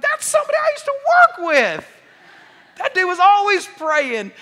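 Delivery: high joyful yell with a laugh; gleeful cry; excited shout. Pretend. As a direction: shout in delighted recognition. Acted recognition in heaven.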